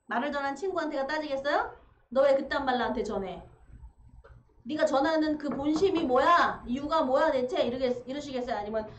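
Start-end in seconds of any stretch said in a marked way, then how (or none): 2.1-7.6 loud, angry confrontation. Acted. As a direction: act out demanding an explanation, voice raised. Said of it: "화가 나서 누구한테 막 가서 따지고". (화가 나서 언성을 높여 따지는 말투)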